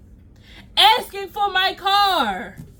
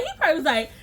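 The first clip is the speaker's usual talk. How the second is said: ordinary talk, nothing marked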